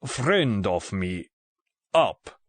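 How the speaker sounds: put-on slow broken English, heavily Russian-accented; thick Russian accent. A narrator's reading. Russian gunman miming a hoist to Bond, thick Russian accent. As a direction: explain word by word in a Russian accent. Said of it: "in a thick Russian accent".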